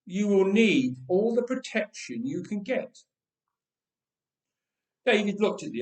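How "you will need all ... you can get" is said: king's voice. Acted. King speaking to David.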